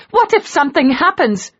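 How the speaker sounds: begging him not to fight; worried plea. A narrator's reading. Wife fears for him before the duel, anxious plea. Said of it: "she cried".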